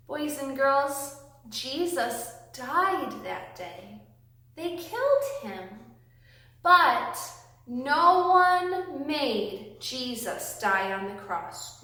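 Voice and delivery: usual speaking voice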